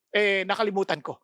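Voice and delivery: normal talking voice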